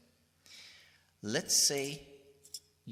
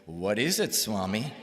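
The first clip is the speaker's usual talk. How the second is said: louder, pressing the question; insistent repeat; 8 dB louder than usual. Retold or imitated. Asking Swami again, louder and insistent. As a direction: ask again, more insistently.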